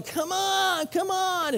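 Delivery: put-on high, pushy kid voice calling; mock impatient kids at the pool, urging on. Kids in line urging a timid kid, high impatient call. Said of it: "And kids are going".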